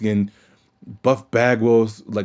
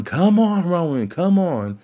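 higher-pitched mock yell at Roman; 6 semitones higher, slower than usual. Podcaster imitating Owens baiting Roman, light mock yell. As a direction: call him out like Owens with a chair. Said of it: "He's yelling".

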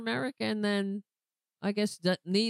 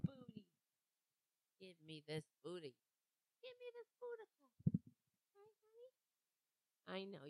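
cooing to the cat; 18 dB quieter, faster than usual. Real, not acted. Owner coos softly to his cat Boudica. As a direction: murmur sweet nothings to the cat on his lap.

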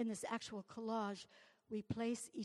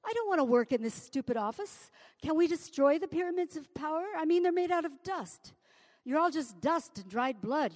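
loud and higher, railing at power; a loud, high, angry poetic tirade; rising protest; 6 semitones higher, 8 dB louder than usual. Acted. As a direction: rant louder and louder at the powerful.

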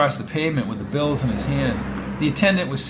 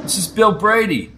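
raised, higher-pitched self-announcement; proclaiming; 6 semitones higher, 5 dB louder than usual. Acted. Brady announcing himself on the phone, proclaiming. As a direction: proclaim your name down the line. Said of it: "he proclaimed".

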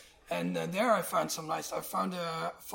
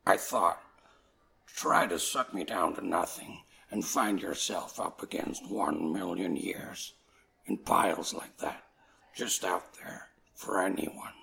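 very low, louder beat-poet growl with whispered bits; [name] voice; 9 semitones lower, 5 dB louder than usual. Retold or imitated. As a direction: drop way down and growl the poem slowly. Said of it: "In [name]' voice".

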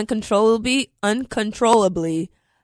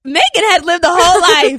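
laughing disbelief; 7 semitones higher, 5 dB louder than usual. Real, not acted. Laughing at friend's crack story.

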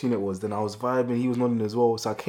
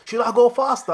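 high mimicking; 13 semitones higher, 6 dB louder than usual. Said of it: "the man's like".

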